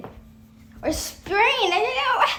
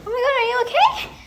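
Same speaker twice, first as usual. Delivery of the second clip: quick, higher-pitched teacher voice; concerned teacher; 8 semitones higher, faster than usual. Retold or imitated. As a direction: rush it out with alarm, imitating a teacher. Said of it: "the teacher, she was like".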